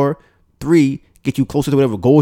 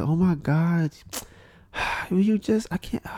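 put-on higher, softer swooning girl; hammed-up breathy swoon; mock swoon; 6 semitones higher, 6 dB quieter, slower than usual. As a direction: sigh it out like she's smitten.

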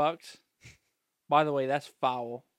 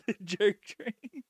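laughing; 6 semitones higher, much slower than usual. Real, not acted. Mocking friend's phrase.

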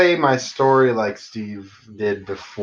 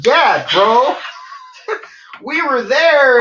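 loud high hype shout; hype yelling; 12 semitones higher, 3 dB louder, slower than usual. Shown as a demonstration. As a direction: yell it like a party bro. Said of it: "you should be more like".

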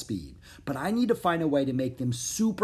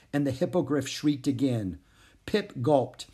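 usual speaking voice

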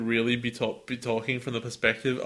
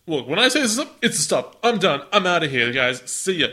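louder, higher brusque train voice; cranky train storming off the job; sassy train; 5 semitones higher, 5 dB louder than usual. Acted. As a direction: bark it like a fed-up conductor quitting. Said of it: "the train's like".

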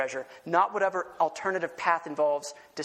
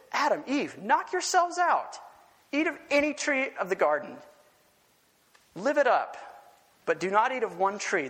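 lavish invitation; 5 semitones higher than usual. Acted. Preacher voices God's generous command.

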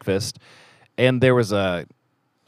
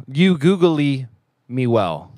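Zoolander quote; 3 semitones higher, much slower than usual. Retold or imitated. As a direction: stretch it out like a dim male model. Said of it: "Shout out to Zoolander right there".

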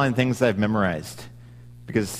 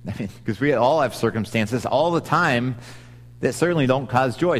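ordinary delivery

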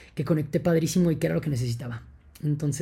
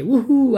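short high whooping cry; ritual whoop; 8 semitones higher, 9 dB louder than usual. Retold or imitated. Retelling the ceremony to listeners, high loud ritual whoop. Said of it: "haciendo un rito de que".

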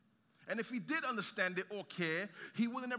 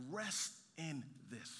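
gentle invitation; 5 semitones lower, 6 dB quieter, slower than usual. Real, not acted. Gentle call to rest in grace.

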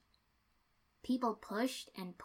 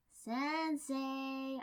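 higher, drawn-out call of Sensei; louder second try; 6 semitones higher than usual. A narrator's reading. Shikako calls again to the teacher ignoring her, higher and drawn out. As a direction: stretch Sensei high to get him to look up. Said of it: "I tried again".

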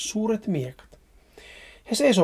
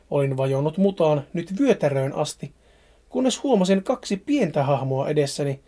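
plain reading voice

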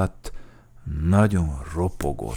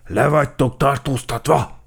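loud, raised official proclamation; forceful police command; arrest order; 8 semitones higher, 8 dB louder than usual. A narrator's reading. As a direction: announce the arrest with full authority. Cheetah officer announces an arrest.

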